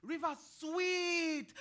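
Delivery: slow saying. Acted. Old woman speaking as a proverb.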